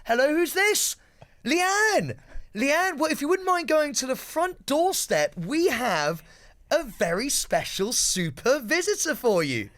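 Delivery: high, bright mock host voice; daytime TV presenter. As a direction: sell the segment like a chirpy host.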